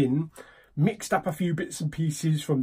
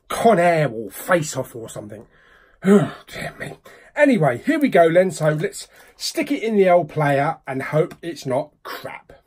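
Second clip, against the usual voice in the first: ordinary talk, nothing marked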